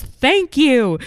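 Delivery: high, loud tipsy "thank you"; sloppy drunk outburst; drunk cheer. Retold or imitated. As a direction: blurt it like a drunk guest. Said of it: "who is so drunk, gets up and goes".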